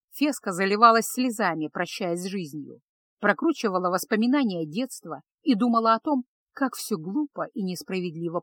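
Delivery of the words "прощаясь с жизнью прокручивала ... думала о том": plain reading voice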